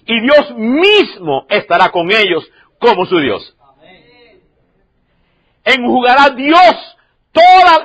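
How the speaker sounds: loud, slow, high proclaiming of scripture; scripture proclaimed. Acted. Pastor reading Revelation aloud to the church, slow and loud. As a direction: declaim each line slowly like a prophecy.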